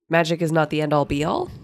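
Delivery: normal talking voice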